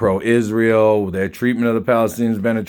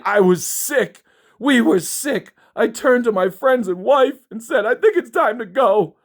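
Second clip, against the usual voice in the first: high, exaggerated sarcastic parody voice; mock the writer's fake distress; squeaky high mock of a frightened man; mocking whiny voice; 11 semitones higher than usual. Mocking the post's author.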